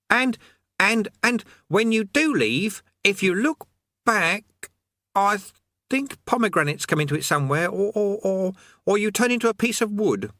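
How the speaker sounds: put-on a keen boy showing off his learning; know-it-all boy Eric.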